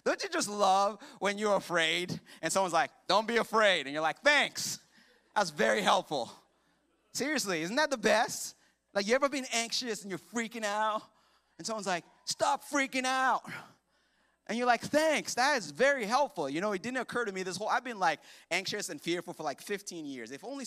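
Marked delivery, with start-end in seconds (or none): 3.1-3.9 loud, punchy unhelpful advice; mock blunt advice. Acting out unhelpful advice to anxious. 4.2-6.3 loud, sarcastic thanks; mock-grateful sarcasm. Sarcastic reply to useless advice. 12.2-13.4 high, loud, drawn-out order; mock yelled command. Acting out useless advice, yelled. 14.8-16.5 higher, loud, over-sincere thanks; mock-grateful sarcasm. Sarcastic reply to useless advice.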